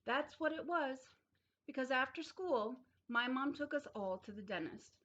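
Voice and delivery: ordinary delivery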